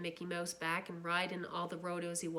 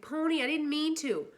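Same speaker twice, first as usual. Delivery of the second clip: loud, much higher desperate call; screamed; 9 semitones higher, 7 dB louder than usual. Acted. Brother screaming after the boy running away. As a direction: scream after him as he runs. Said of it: "Derry screamed".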